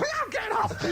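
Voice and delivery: very high indignant squeal; mock outraged shriek. Driver of the wrong car shoving him out, high mock-offended shriek.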